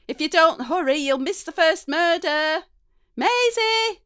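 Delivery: high loud call, last name stretched; Mum shouting. Acted. Mum calling daughter to the telly, loud shout. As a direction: yell the name up the stairs. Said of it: "Mum shouts up the stairs".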